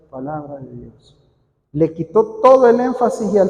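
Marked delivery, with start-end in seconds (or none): 0.1-1.2 softer, lower, emphasis drained away; dropped intensity. Retold or imitated. Lector trainer imitating a reader's weak ending, dropped intensity. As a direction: let the closing phrase sink away, quiet and low. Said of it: "le bajó la intensidad".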